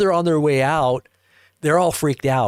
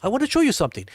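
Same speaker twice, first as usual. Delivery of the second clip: higher, imitating an insistent stranger; raised-pitch mimicry of the man; 7 semitones higher, much faster than usual. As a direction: repeat it as an eager retold invitation. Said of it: "And he goes".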